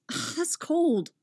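high, drawn-out recoil from cold; hissed complaint. A narrator's reading. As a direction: hiss it like the cold stings. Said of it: "Tim hisses".

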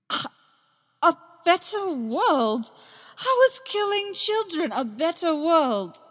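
put-on Obi-Wan horrified by Anakin's excuse for killing children, outraged disbelief. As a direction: choke on the words, then rage at him. Obi-Wan confronting Anakin's massacre.

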